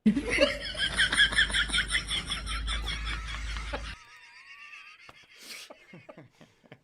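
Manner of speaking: long shrieking laugh; long high laugh. Real, not acted. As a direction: let out a long squealing laugh. Rewatching the clip.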